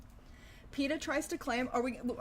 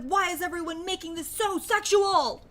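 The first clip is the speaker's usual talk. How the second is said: pitched up and louder, playing the offended activist; mock indignant; 7 semitones higher, 6 dB louder than usual. Imagined reply to chat's jokes, mock outrage. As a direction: act scandalized at the jokes. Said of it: "And I'm like".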